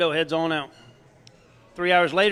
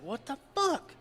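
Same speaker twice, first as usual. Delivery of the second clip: amazed Boudreaux; 8 semitones higher, 8 dB quieter than usual. Acted.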